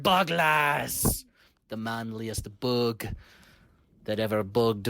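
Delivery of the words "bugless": bug's war cry. Acted. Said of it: "if you were a bug there, you'd have heard".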